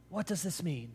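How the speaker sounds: hushed, bewildered question; puzzled aside. Acted. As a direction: ask your neighbour softly, puzzled. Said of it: "imagine them turning to one another".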